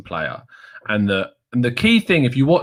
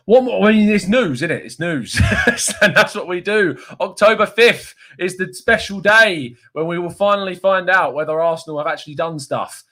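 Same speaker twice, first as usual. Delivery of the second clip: high, worked-up excitement; too excited; 5 semitones higher than usual. Real, not acted. Host hyping transfer news to viewers, too excited. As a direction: get carried away, pitch climbing. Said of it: "I'm getting too excited now".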